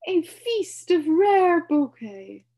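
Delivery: high, loud, grand humbug voice; pompous announcement. A narrator's reading. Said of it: "he announced to no one".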